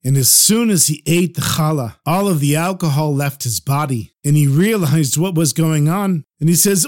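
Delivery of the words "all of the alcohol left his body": plain reading voice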